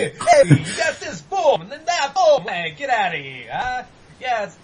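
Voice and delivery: loud, high, hammed-up Guido accent. Podcaster riffing on a failed impression, loud Guido accent. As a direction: shout it out in a cartoon Guido accent. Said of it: "this weird Guido accent".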